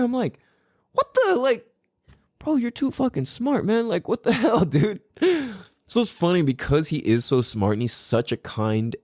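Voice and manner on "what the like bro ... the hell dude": much higher, laughing amazement; mock disbelief. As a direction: marvel at the son, playfully. Amazed at son's wisdom.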